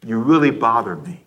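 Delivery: low, irritated undertone; irritated vibe. Acted. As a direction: let mild annoyance sit low in the voice. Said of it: "a vibe that says".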